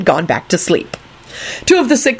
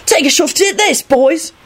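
challenging; 10 semitones higher than usual. Acted. Alex challenging friends.

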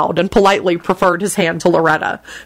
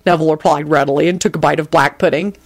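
ordinary delivery, nothing marked

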